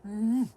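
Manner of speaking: loud "mm" of relish; emphatic yummy hum; food sound. Real, not acted. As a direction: hum with appetite. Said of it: "That was a food sound".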